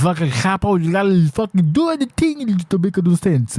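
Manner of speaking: mocking mushmouth voice, pitch climbing; mushmouth imitation. Host mocking a wrestler he dislikes, mushmouth impression. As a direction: mimic a mushmouth wrestler's rambling. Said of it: "I'm not a mushmouth fuckface".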